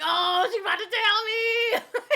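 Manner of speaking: laughing. Retold or imitated. Excited retold thought, laughing.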